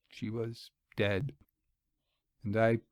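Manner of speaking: barely able to say it; quiet numb shock; hushed grief. A narrator's reading. News of her death.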